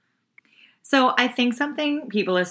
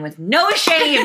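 "no shame" stretched out high; drawn-out emphasis; 7 semitones higher, 4 dB louder, much slower than usual. Real, not acted. Marvelling at how boys are raised, emphatic "no shame". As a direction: slowly lean on "no shame", amused. Mock outrage at shameless men.

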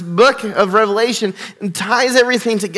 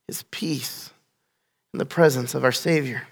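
hushed and soft; 7 semitones lower, 7 dB quieter than usual. Real, not acted. Preacher to congregation about heaven's peace, soft and hushed. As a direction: drop to a soft, hushed calm.

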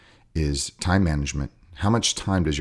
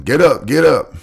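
mock coach yell; 8 semitones higher, 7 dB louder than usual. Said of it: "by simply telling them".

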